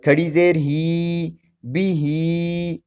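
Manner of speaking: "ہی" held long, "بھی" kept short; two-beat stretch. Shown as a demonstration. Teacher shows standing zer to viewers, stretching "ہی". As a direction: draw out each "ہی" for two beats. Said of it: "دو حرکت کے برابر کھڑی زیر کو کھینچیں گے".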